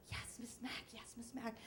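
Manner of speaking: imitating meek kids in a near-whisper; very soft, hushed little replies; quiet schoolkids. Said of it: "the bilingual kids were so quiet".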